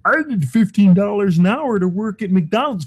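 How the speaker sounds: high, whiny put-on voice of a complainer; mocking whiny worker. Ranting host mocks fast food workers in a high whiny mock voice.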